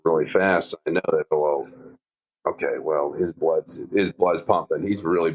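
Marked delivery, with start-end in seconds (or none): none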